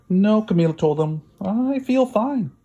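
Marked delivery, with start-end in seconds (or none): 0.1-0.4 put-on girl's voice. Girl answers doctor. 1.4-2.5 put-on high, slow girl's reply; a girl insisting calmly that she is well. Girl to the doctor, high and slow.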